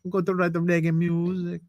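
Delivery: a weak fake Jamaican accent. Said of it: "That's the worst Jamaican accent I've ever heard".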